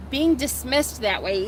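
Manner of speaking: usual speaking voice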